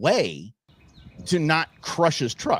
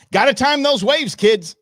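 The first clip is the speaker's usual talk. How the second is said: called-out advice; 9 semitones higher, 6 dB louder than usual. Real, not acted. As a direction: holler it like a warning. Mock advice after the wipeout.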